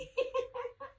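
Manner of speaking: high, breathy giggle; giggling at herself; laugh. Real, not acted. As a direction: let a real laugh slip out. Laughs after explaining the joke.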